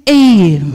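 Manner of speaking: "a" held long as a lone vowel; isolated vowel. Shown as a demonstration. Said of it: "remove, uh, all the vowels".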